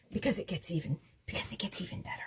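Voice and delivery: conspiratorial whisper; hushed aside to the viewer. Acted. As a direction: lean in and whisper the secret.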